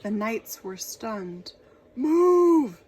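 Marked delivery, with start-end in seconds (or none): 1.9-2.8 loud high shout. Acted. Knights shouting at Boy to get away, loud shout. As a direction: yell it at the boy. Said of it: "they shouted".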